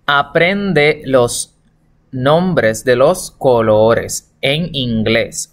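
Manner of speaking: slow, measured Spanish in short chunks; slow dictation. Shown as a demonstration. Teacher translates instructions for pupils, slow and deliberate. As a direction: spell out the instruction phrase by phrase.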